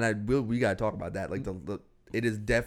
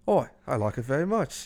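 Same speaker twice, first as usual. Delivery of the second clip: a light put-on Irish-English accent, nearly Australian; mock Irish-English child accent. Imitating the son answering his dad, mock Irish-English accent. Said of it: "obviously Irish kids or English kids".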